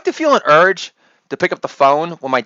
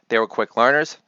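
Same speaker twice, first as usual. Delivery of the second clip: ordinary talk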